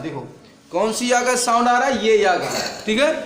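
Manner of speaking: usual speaking voice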